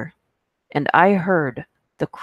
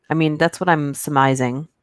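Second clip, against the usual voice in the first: ordinary talk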